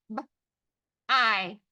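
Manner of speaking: short B, pause, long I; sounded out. Shown as a demonstration. Teacher tapping the first syllable, sounded out. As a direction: break b-I into single sounds. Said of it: "First syllable".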